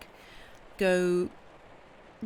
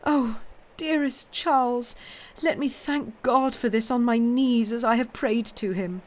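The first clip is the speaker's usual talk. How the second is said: grateful wife; 6 semitones higher, 5 dB louder than usual. Acted. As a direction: overcome with relief, voice lifted. Lucy's tearful thanks.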